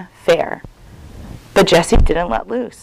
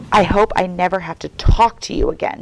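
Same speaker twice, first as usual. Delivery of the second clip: usual speaking voice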